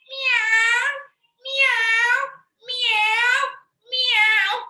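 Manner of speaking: loud, high-pitched meows; long, piercing mimicked cat cries; imitated cat meow. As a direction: meow loudly like a pretend cat. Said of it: "Which animal is this?".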